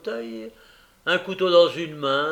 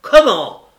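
loud, high, indignant cry; outraged outburst; 9 semitones higher, 16 dB louder than usual. Acted. Bumble bursting in on the couple, outraged. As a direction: burst in with a shocked, angry shout.

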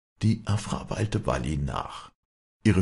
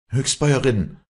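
high, loud narrated outburst; breathless blurt; 10 semitones higher, 8 dB louder than usual. A narrator's reading. Girl confronting the farm woman, breathless. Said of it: "stieß Afra atemlos hervor".

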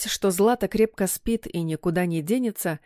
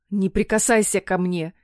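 ordinary delivery

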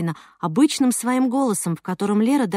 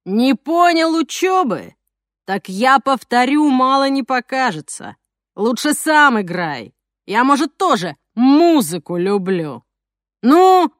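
slow, louder gloating villain; slow theatrical villain menace; 2 semitones higher, 5 dB louder, slower than usual. Acted. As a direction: savor each threat slowly. Thug Zhokh threatening.

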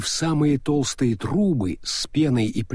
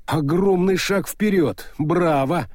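forced cheer, a bit louder; desperate exclamation; 7 semitones higher, 3 dB louder than usual. A narrator's reading. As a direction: cry out with forced enthusiasm. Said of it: "отчаянно воскликнул Мокриц".

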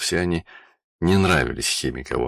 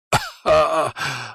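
villainous laugh; 12 semitones higher than usual. Acted.

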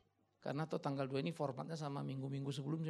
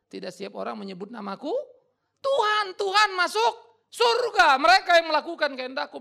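proclaimed scripture; 12 semitones higher, 16 dB louder than usual. Acted. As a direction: thunder the verse. Quoting Jesus in Matthew 7.

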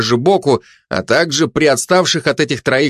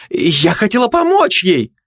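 high-pitched protest; 8 semitones higher than usual. A narrator's reading. Gossip excuses herself, startled.

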